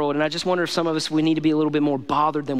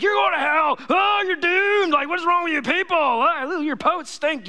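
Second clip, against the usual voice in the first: much higher, hammed-up judgmental preaching; mock hellfire preacher; 11 semitones higher, slower than usual. Pastor mocking a harsh preacher to the church, hammed-up high shout. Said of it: "he didn't start by being like".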